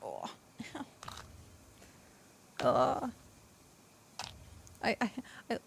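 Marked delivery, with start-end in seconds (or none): none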